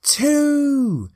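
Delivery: high, ringing crowd call; excited shout. Retold or imitated. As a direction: call out the countdown. Crowd countdown, louder each number.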